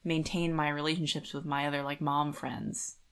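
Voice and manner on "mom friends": usual speaking voice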